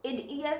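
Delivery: "id ear" with short i, split after the d; wrong syllable break. Shown as a demonstration. Teacher repeating the misreading, wrong break. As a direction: misread idea as two words, "id ear". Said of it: "We cannot read it id ear".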